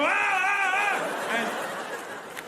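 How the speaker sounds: high mock screams of terror; acted high-pitched wailing; panicked scream. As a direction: scream like a terrified passenger. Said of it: "And you go".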